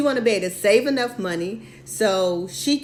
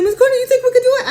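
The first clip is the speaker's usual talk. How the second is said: much higher, louder, fast and breathless; over-excited; 13 semitones higher, 9 dB louder, much faster than usual. Retold or imitated. Client calling her mentor about the deal, bursting with excitement. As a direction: gush it out like she can't contain herself. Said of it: "You gotta calm down".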